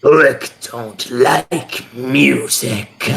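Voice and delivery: slow, drawn-out lizard creature; big lizard speaking very slowly; very deep voice. Acted. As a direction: stretch every word out in a heavy monster voice. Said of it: "like, a very deep voice".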